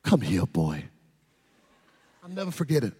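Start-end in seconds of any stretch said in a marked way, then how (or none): 0.0-0.8 very low dad voice; deep paternal command; stern father. Retold or imitated. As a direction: summon the boy in a deep, grave voice. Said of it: "My dad said".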